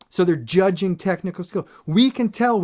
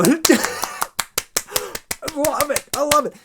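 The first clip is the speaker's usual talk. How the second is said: gushing audience; 11 semitones higher than usual. Retold or imitated. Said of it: "they go".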